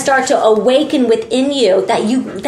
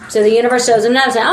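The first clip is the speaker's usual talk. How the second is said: normal talking voice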